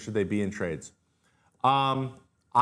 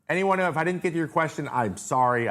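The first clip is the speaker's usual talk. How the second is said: ordinary talk, nothing marked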